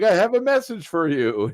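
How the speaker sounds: high, loud showy announcement; theatrical villain. Acted. Host mimics Ruckus addressing the boat, hammed-up proclamation. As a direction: announce it like a hammy cartoon villain.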